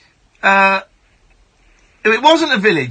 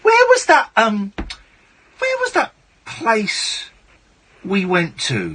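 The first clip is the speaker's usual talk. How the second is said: put-on Dudley accent; 3 semitones higher, slower than usual. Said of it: "Why are you doing the Dudley voice?".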